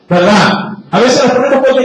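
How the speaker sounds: ordinary delivery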